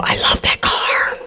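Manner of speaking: soft unvoiced whisper, slow; whispered aside. Real, not acted. Comic whispering her love for the old Samurai. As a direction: breathe it like a secret. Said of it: "whispered aside about her car".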